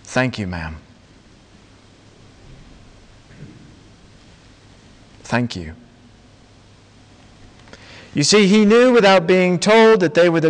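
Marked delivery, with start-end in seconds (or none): 0.0-5.7 low, hushed thanks from a big man; moved prisoner. Acted. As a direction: drop low and soft, humbly grateful. Weeping prisoner thanks old lady.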